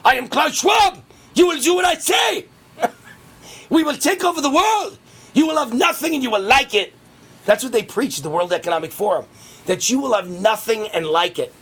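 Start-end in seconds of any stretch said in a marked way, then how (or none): 0.0-6.9 higher, louder mock German accent; hammed-up German tyrant, shouting orders; cartoon German villain, booming. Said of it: "sounds like and looks like a German Nazi".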